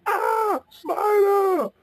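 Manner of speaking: very high shriek at a spider; high-pitched cartoon scream; frightened yell. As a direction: scream out in acted terror, drawn out. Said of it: "he yelled".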